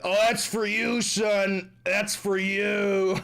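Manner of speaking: high, drawn-out mock announcement; hammed-up high voice handing over a gift; imitating TikTok. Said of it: "TikTok was really like".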